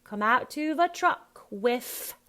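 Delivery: loud, high "with" as wiff; WITH ending in a long f; Cockney th-as-f. Shown as a demonstration. Coach modelling the line, Cockney th-as-f. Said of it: "F for with".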